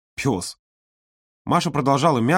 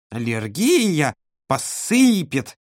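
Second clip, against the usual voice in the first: mocking imitation; 3 semitones higher, much slower than usual. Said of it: "передразнила Ирину".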